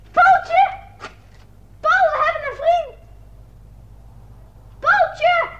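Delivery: high shout over the wall; a child calls loudly to his friend; calling out. Acted. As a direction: call your friend across the wall. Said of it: "Ik moet niet schreeuwen".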